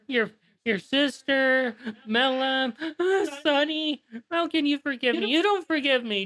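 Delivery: high, pleading and tearful confession; tearful dragon. Acted. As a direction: beg forgiveness through tears, pitched high. Grieving dragon begs for forgiveness.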